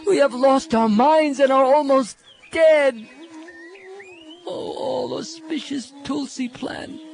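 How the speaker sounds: pleading gopis. Acted. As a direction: beg every tree as if your beloved is lost. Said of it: "Speaking as the gopis, he said".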